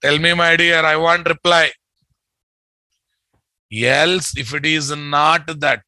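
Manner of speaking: ordinary delivery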